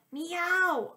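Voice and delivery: high, loud cat howl; howling meow. A narrator's reading. Cat plopped into the bath, howling meow. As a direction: howl it like a cat dunked in water. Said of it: "I howled".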